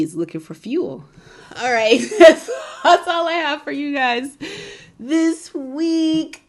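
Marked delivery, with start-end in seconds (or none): 1.6-6.4 laughing through the sign-off. Real, not acted. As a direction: giggle while wrapping up. Laughing while signing off.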